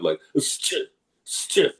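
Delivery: "st-" as a hissed Russian shch cluster; Russian sound demo. Shown as a demonstration. Showing interviewer a Russian sound, "st-" as hushing shch. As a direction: show the foreign "st-" sound, each one separate. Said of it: "a sound that we don't have".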